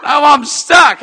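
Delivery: high, loud, slow groaning complaint; frustrated shout. Retold or imitated. After hanging up with her, frustrated shout. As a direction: moan it out in frustration. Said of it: "I go".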